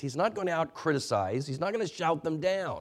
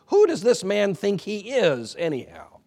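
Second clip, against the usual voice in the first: indignant question; 7 semitones higher, 4 dB louder than usual. Retold or imitated. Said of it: "quit asking ourselves questions like".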